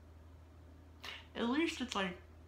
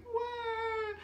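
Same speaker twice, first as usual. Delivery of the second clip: put-on baby's shocked cry. Baby self at photo shoot.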